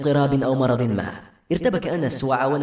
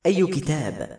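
ordinary delivery

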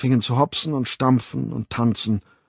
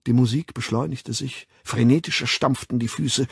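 ordinary delivery, nothing marked

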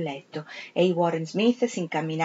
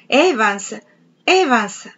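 loud, higher cry of Evans; 3 semitones higher, 5 dB louder, much slower than usual. Acted. Servant recounting Septimus calling Evans, loud cry. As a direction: call the name out, rising.

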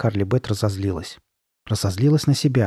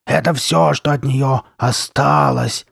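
loud, drawn-out threatening voice; menacing monster; 3 semitones higher, 5 dB louder, slower than usual. A narrator's reading. Masked girl showing boys the head, menacing. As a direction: proclaim it slowly and ominously.

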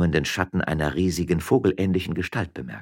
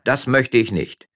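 loud, slow, higher-pitched refusal; loud answer; 8 semitones higher, 8 dB louder than usual. Acted. Miller turns down his wife's offer, loudly. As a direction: refuse out loud, measured and emphatic. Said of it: "antwortete Gevatter Lukas laut".

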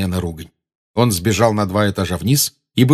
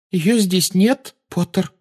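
slow, sorrowful matron; sad; 11 semitones higher, slower than usual. A narrator's reading. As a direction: break it to him gently. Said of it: "грустно ответила мадам Помфри".

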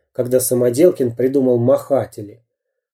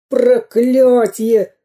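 high, very slow curse; long-drawn villainous wail; bandit's howl; 11 semitones higher, 4 dB louder, much slower than usual. A narrator's reading. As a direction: howl it out in frustration. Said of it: "завопил разбойник".

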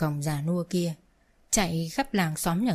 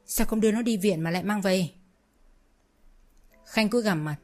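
ordinary delivery, nothing marked

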